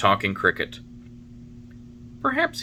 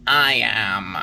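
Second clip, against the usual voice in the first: Talking Cricket; 6 dB louder than usual. A narrator's reading. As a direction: voice the old cricket, stern and knowing. Cricket voice in story.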